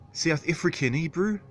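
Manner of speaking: high-pitched mock New Zealand accent; mistaken New Zealand accent. Attempting South African for the chat, high New Zealand accent. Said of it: "No, that's New Zealander, sorry".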